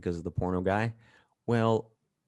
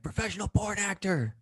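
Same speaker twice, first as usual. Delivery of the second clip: high mock yell of the buddy; yelled reply; 12 semitones higher than usual. Buddy Dan shouting back over the club, mock yell. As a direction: imitate the friend shouting back. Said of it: "And he yells back".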